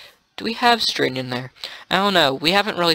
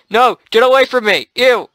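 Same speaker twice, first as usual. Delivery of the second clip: shouting; 6 semitones higher, 5 dB louder than usual. Real, not acted. Fleeing a player.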